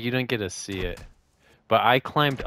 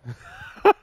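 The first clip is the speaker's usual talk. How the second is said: high laugh; 7 dB louder than usual. Real, not acted. Post-game laughter.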